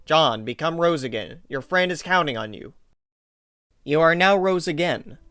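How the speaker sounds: usual speaking voice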